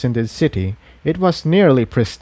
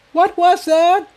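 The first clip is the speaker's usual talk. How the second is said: shout. Acted. Tiptoe shouts after the boom, alarmed. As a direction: call out in alarm. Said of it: "Tiptoe shouted".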